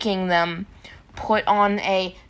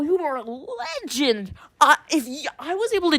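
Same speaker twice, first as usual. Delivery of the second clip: high, loud amazed gasps; amazed excitement; 8 semitones higher, 5 dB louder than usual. Pretend. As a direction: gush in disbelief at the stowaway. Amazed at stayer on flight.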